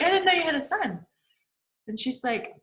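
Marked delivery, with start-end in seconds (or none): 0.0-1.0 high, quick, surprised quoting of the husband; a raised, hurried mock of the date's reply; surprised husband again. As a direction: repeat his startled line fast and high. Repeating the husband's reply faster.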